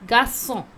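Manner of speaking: "Garçon" said "ga-SON", crisp s for the ç. Shown as a demonstration. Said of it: "you have to pronounce S".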